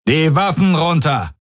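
high-pitched urgent order; guard calling out, pitch jumping up; guard's command. Acted. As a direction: call out a sharp command to disarm. Guards order intruders to disarm.

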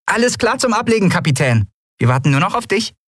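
quick, high-pitched ship's report; mock salute. A narrator's reading. Nelen reports ready to her captain, mock-saluting. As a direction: report brightly to the captain in parody. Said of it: "parodierte einen Salut".

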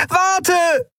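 very high desperate shout; woman screaming wait. A narrator's reading. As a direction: shout wait with all you have. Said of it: "schrie sie".

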